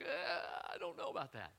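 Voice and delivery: quiet, breathy hesitant hedge; mock reluctance. Believers hedging when God speaks, quiet and reluctant. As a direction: hem and haw softly.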